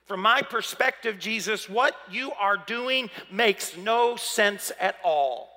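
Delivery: slow, emphatic exasperation; frustrated. Acted. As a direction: spell out the reproach word by word, slowing down. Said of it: "incredible frustration in what she expresses".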